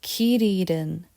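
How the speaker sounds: quick, run together; fast. Shown as a demonstration. Teacher showing the quick run-together Korean form. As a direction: say it fast and connected. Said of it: "But if I say fast".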